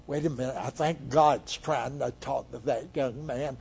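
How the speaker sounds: soft aside of someone working it out; lightly voiced quiet pondering; dawning realization. Eli realizing, voiced in story.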